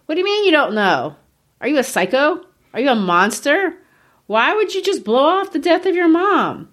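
a touch of acted outrage, pitch leaping on the questions; mock incredulity. Host plays appalled reader questioning the narrator, mock incredulous. Voices a shocked reader confronting Meursault.

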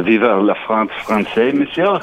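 slow, put-on French accent, leaping up at the end. Shown as a demonstration. Asked to show off his accent on air, hammed-up French accent. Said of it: "give us your French accent".

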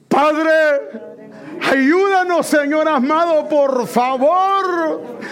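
high, sustained crying out to God. Real, not acted. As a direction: plead with the Lord at full force, stretching the words. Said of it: "vamos a levantar la voz".

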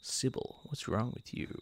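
breathy near-whisper plea; soft whispered concern; hushed interpretation. Retold or imitated. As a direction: hush it as if to a sick dog. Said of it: "Let's do our own interpretations".

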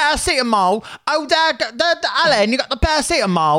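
very high-pitched hammy British voice; mock British accent. Co-host mimicking how Brits all say paracetamol, mock British accent. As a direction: squeal it like a caricature Brit. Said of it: "I love how they're all like".

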